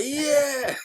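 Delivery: long, high, full-throated yell; screaming out. Acted. Random gym-goer answering the online instructor, screaming. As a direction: scream it like an over-keen class member. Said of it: "screaming out".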